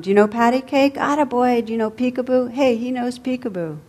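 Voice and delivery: shouting across the room. A narrator's reading. Old bum shouts to the baby from across the room. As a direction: call out playful games to the baby. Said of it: "the old bum was shouting from across the room".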